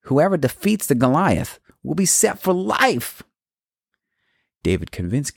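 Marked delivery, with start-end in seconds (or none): 0.1-3.2 higher, excited soldier voice; eager soldier. Acted. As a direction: answer like a hyped foot soldier. Soldier answers David's question.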